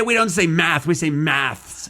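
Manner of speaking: slow sing-song mock British correction; mocking correctors. Host mocking British commenters, slow sing-song.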